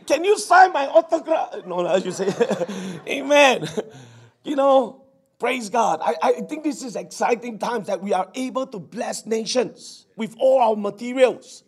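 ordinary talk, nothing marked

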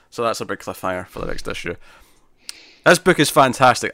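ordinary delivery

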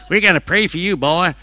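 mild impression of a devout old lady; old Southern lady. Old lady promising the preacher prayers, lightly voiced. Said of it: "And they said".

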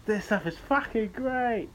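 high, slow mock advert pitch; ad read voice. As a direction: oversell it like a cheesy ad.

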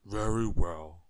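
loud, slow booming giant. A narrator's reading. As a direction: pronounce it ponderously as a huge giant. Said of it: "said the giant".

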